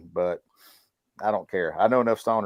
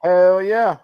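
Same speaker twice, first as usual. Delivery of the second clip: loud, high whoop of approval; a loud, high hell yeah; excited cheer; 9 semitones higher, 10 dB louder than usual. Real, not acted. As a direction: cheer it like a fan at a show. Cheering a stripper joke.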